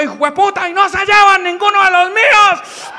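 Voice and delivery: high, loud mock-furious yell; comic battle cry, rising in pitch; grandfather yelling. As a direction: shout defiance like an enraged grandpa. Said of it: "Y dijo".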